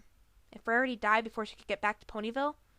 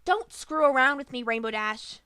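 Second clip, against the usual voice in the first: much louder angry bellow; bellowed angrily; 3 semitones higher, 8 dB louder than usual. A narrator's reading. Griffin confronts friend over hidden news, bellowing. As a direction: bellow it at her, furious. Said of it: "Gilda bellowed angrily".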